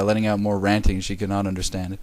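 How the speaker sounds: usual speaking voice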